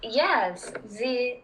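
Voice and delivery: slow French accent with a stutter. Shown as a demonstration. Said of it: "French people, they, they always do the stutter".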